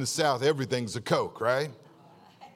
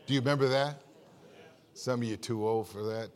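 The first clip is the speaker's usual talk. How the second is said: ordinary delivery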